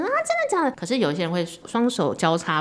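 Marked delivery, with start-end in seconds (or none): 0.0-0.7 high, breathy mock disbelief; surprised. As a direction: gasp it out in delighted surprise. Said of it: "就是语气啊".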